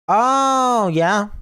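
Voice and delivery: high, loud oh yeah; excited exclamation; sudden excitement. Real, not acted. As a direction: blurt it out remembering. Sudden aside mid-explanation.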